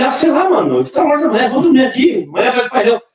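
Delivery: a hint of a high, shrugging young fan; lightly voiced nonchalant fan. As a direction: toss it off like it's nothing. Voicing the fan's casual answer.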